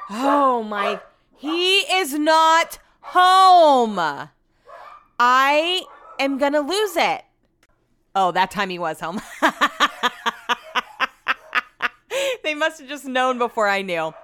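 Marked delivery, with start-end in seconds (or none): 0.1-7.2 loud, slow, drawn-out frustration; real exasperation, high and slow; exasperated outburst. Real, not acted. Barking dogs interrupt her recording. 9.3-11.9 long staccato laugh; real laughing fit in quick even bursts. Real, not acted. Laughs at her mistaken outburst.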